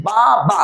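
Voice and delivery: "বাবা" slowly, as two separate beats; split into syllables. Shown as a demonstration. Teacher repeating the two-syllable example for students, split into syllables. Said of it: "দুইবারে বলেছি".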